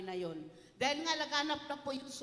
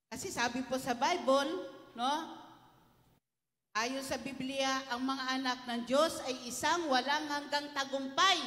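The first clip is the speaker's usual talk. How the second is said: rising, louder sermon build; preaching; 3 dB louder than usual. Acted. Pastor preaching to the congregation, louder and building. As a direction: drive the Bible promise home to the congregation.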